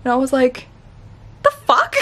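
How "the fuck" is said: the fuck squeaked very high in disbelief; shocked squeak. Retold or imitated. Woman retelling a stranger's odd question, stunned high-pitched reply. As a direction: blurt it out high, caught off guard.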